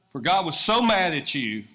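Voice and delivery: punchy wrong reading with "mad" hit hard; mock wrong version. Shown as a demonstration. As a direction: misquote it with a mock glare. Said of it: "That's not what it says".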